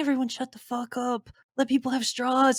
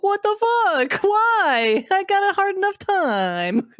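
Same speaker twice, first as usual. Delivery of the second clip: high, loud laughing exasperated yell; hammed-up cry of disbelief through a laugh; mock outrage; 11 semitones higher, 4 dB louder, slower than usual. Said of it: "It's like".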